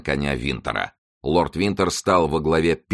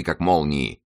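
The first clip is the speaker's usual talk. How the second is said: plain reading voice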